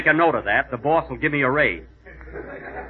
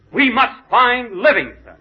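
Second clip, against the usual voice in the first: slow, louder, a touch higher, hammed-up resolve; determined vow; 8 semitones higher, 4 dB louder, slower than usual. Explorer urging the guide onward, slow hammed-up resolve. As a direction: plant each word like a heroic pledge.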